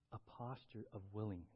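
ordinary talk

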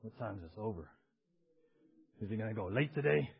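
low, soft grumble of impatience; hushed, low aside from a reluctant churchgoer; clock-watching churchgoer. Acted. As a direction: mutter like a bored clock-watcher. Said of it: "do you come with chronos".

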